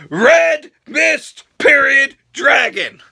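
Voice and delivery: loud announcer-style shout of the names; hype shout. Acted. Shouting wrestler names to viewers, hype yell. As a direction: bellow it like a ring announcer.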